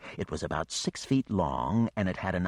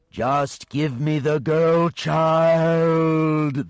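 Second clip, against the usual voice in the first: very slow, much louder and higher monster voice; demanding dragon; 10 semitones higher, 10 dB louder, much slower than usual. Acted. The dragon demands the girl child, huge and drawn-out. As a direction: stretch every word out like a hungry beast.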